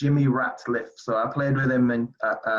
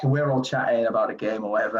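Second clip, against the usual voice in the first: normal talking voice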